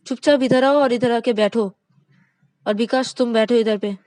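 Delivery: loud, high bossy orders; wife barking orders at the two men; angry command. Acted. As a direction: command them to sit, furious. Wife orders them to sit.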